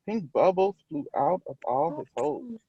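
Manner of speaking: usual speaking voice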